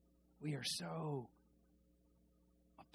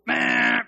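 dismissive meh; 13 dB louder than usual. Acted. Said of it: "shrugging dismissal".

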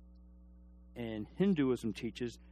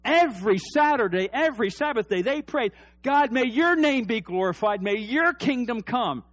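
loud, high preaching shout; rising to a preacher's shout; full-force preaching; 9 semitones higher, 9 dB louder than usual. Acted. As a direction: build the sermon to a cry.

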